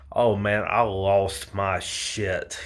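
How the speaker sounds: ordinary delivery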